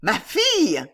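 exclaim, scandalised; shocked exclamation. A narrator's reading. Old lady learning it was her daughter, shocked.

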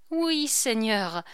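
ordinary delivery

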